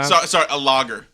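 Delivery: fast hammed-up British accent; mock British accent. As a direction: rattle it off like a Brit ordering at the bar. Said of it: "a British accent".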